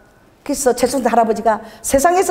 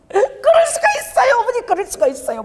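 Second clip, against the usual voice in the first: crying complaint; 13 semitones higher than usual. Retold or imitated. Son-in-law calling in tears, high sobbing protest. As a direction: play the son-in-law weeping and pleading. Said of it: "막 울면서".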